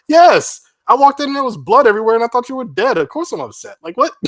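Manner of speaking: high, loud, fast outraged protest; outraged victim. Retold or imitated. The pranked partner yelling back at the prankster, high, loud and fast. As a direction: blurt it out as the upset victim.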